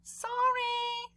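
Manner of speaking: high, small apologetic voice; a kid caught out, saying sorry; high sheepish kid. Acted. Clark apologizing at lunch.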